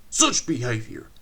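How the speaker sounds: put-on old engine scolding coaches; stern scolding. A narrator's reading. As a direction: keep telling them off.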